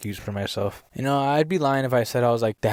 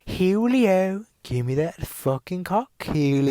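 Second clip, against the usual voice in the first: imitating a British-sounding European accent, higher; hammed-up British-like European accent, pitched up; mock European accent; 8 semitones higher, slower than usual. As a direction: put on a near-British European accent to mimic her. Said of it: "she had, like, a little accent".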